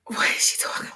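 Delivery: breathless whisper of disbelief. Retold or imitated. Stylist reacting to the mentor's correction in a whisper. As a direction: whisper it like you can't believe what you heard.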